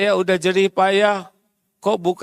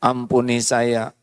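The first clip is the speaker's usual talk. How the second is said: lower, hushed prayer of repentance; humble repentant prayer; 7 semitones lower than usual. Retold or imitated. Husband confessing his failings to God in a low humble prayer. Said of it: "Istighfar dulu".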